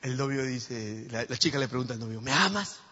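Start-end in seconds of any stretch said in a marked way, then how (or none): 2.2-2.8 put-on higher pleading girlfriend; mock girlfriend asking. Girl to boyfriend, a mock pleading ask. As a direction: play the needy girlfriend.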